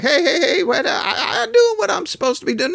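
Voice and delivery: high, laughing, squeaky protest; sheepish excuse. Retold or imitated. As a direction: plead innocence with a nervous laugh. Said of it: "He's gonna be like".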